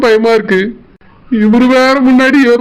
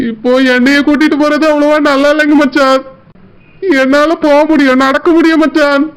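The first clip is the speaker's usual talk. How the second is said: ordinary talk, nothing marked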